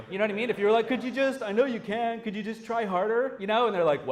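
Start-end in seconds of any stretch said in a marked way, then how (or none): 0.9-3.2 higher, louder exasperated plea; mock angry developer pushing for more. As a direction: needle them like a fed-up developer. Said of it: "like an angry developer would do".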